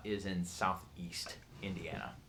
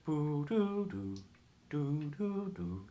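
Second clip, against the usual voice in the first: Morse beeping; 6 semitones higher, much slower than usual. Shown as a demonstration. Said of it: "you would hear just the constant".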